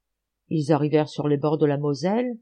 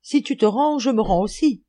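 high, loud surrender call; echoed cry; 6 semitones higher, 5 dB louder than usual. A narrator's reading. Six companions surrendering after Schultz, echoed cry. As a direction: call out high, following the leader. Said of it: "crièrent à leur tour".

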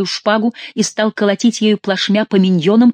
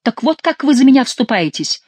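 high-pitched, wounded outburst; indignant reproach; 8 semitones higher, 4 dB louder than usual. A narrator's reading. King to his mother who won't back him, indignant. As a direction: throw the reproach at them, pitched up.

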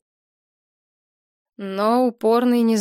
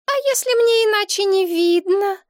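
put-on very high, louder petulant girlfriend; capricious whine; 13 semitones higher, 5 dB louder than usual. A narrator's reading. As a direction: pout it in a high girlish voice. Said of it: "капризным голосом ответила девица". (капризный высокий голос)

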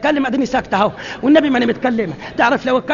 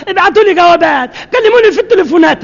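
higher, louder mock reproach; indignant loud scolding; 5 semitones higher, 6 dB louder than usual. The woman loudly scolds her neighbor for not phoning.

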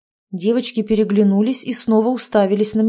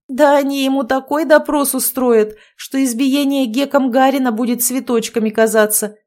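mildly scoffing, higher and louder; snorting scorn; 5 semitones higher, 4 dB louder than usual. A narrator's reading. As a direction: toss it off with a light scoff. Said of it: "фыркнула Машка". (насмешливо фыркая)

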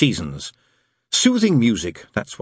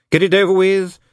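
hurried; 8 semitones higher, 4 dB louder than usual. A narrator's reading. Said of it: "he added hurriedly".

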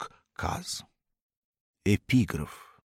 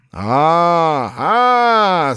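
high, loud bellowed syllables; loud furious howl; raging cry; 8 semitones higher, 12 dB louder than usual. Acted. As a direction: erupt in fury. Boss erupts in rage.

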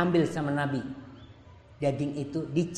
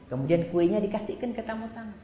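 normal talking voice

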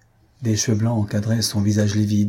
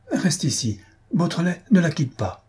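higher, hurried orders; urgent command; 7 semitones higher than usual. A narrator's reading. Lupin ordering Beautrelet to stay with Raymond, urgent. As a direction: fling the orders back while running off.

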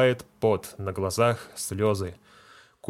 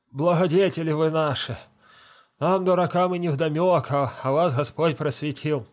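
higher, lightly pleading voice of a humble deacon; fawning deacon; 6 semitones higher, 3 dB louder than usual. Acted. Deacon flattering the feldsher, higher and pleading. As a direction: grovel before the man with the tongs.

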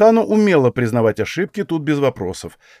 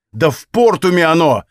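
raised, loud exasperated outburst; exploding; 6 semitones higher, 5 dB louder than usual. A narrator's reading. Hero blows up at the woman about the wine, exploding. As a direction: blurt it out in anger, higher and louder. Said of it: "взорвался я".

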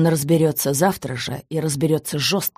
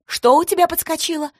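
much higher, shrill mocking yell; 12 semitones higher, 3 dB louder than usual. A narrator's reading. Wife yelling at husband's excuse, furious. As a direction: throw his excuse back in a roar. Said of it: "заорала жена".

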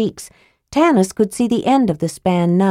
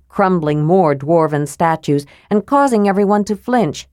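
ordinary delivery

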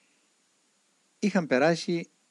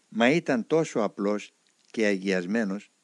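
plain reading voice

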